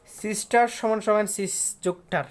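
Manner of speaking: loud, slow split of সিস and টার; syllable-split demonstration. Shown as a demonstration. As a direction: break sister deliberately into সিস, then টার. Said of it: "এটাকে syllable করলে কি হয়".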